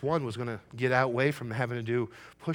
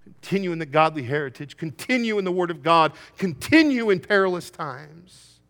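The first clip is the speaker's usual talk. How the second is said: loud, rising call to continue; preaching at full force; 6 semitones higher, 11 dB louder than usual. Acted. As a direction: drive each line home. Preaching climax.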